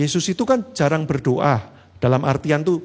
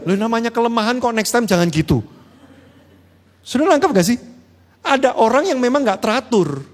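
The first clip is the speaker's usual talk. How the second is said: ordinary talk, nothing marked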